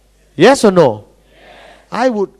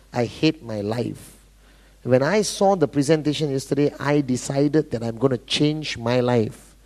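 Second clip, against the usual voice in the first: low, deliberate, dead-serious pledge; acting out a committed recruit; determined prospect; 9 semitones lower than usual. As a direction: speak low and measured, weighing every phrase. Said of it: "you got somebody who walks up to you, looks you in the eye".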